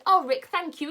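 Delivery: put-on a girl overjoyed with a gift; delighted girl. Franny delighted by necklace.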